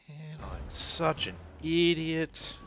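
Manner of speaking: high, slow groan of self-blame; exasperated self-scolding. Real, not acted. Player kicking himself over a missed gold mine, slow exasperated groan. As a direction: drag it out, annoyed at yourself.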